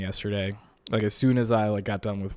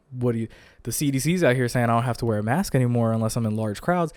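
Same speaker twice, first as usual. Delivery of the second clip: usual speaking voice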